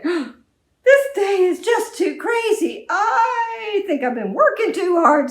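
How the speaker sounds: high, loud, slow lament; frazzled farmer. Acted. Farmer wails about his crazy day, high and loud.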